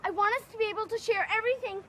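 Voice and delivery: loud, high, urgent protest; insistent pleading. Acted. Pleading insistence in quarrel with friend.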